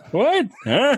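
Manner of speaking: put-on high, confused toothless old man. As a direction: squawk like a deaf old man. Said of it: "Where, where are my teeth?".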